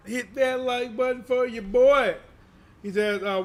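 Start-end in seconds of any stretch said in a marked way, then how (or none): 0.1-2.2 drawn-out hype shout-out. Acted.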